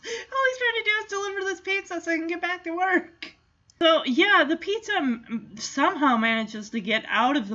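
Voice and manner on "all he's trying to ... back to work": high, fast, whiny lament; mock-whiny sympathy. Feeling for the poor pizza man, high mock-tearful whine. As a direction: wail lightly on someone's behalf, playfully.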